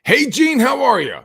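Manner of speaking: high, loud cheerful hello; upbeat tone. Shown as a demonstration. Showing callers how an upbeat tone sounds. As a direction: lift the whole greeting up. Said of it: "tonality, up and down".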